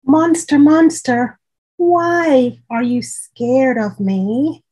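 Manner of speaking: high, loud call, then a slow puzzled question; brave call. Acted. Brave child calling monster.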